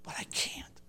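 tearful plea that sinks to a whisper; crying. Retold or imitated. Grieving husband tells his wife he can't bring the past back, through tears. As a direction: voice breaking, then fading to a whisper. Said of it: "in tears, I cried with".

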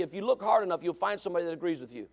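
normal talking voice

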